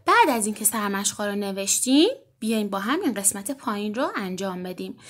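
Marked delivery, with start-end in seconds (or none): none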